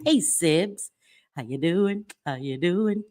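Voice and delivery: ordinary delivery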